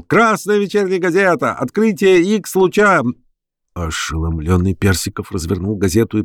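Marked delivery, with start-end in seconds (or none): 0.0-3.0 high, carrying street cry; howling newsboy. A narrator's reading. Boy hawking the evening paper, howling high. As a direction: wail the headline like a paper seller. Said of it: "вновь завыл".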